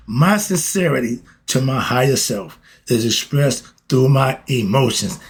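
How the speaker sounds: usual speaking voice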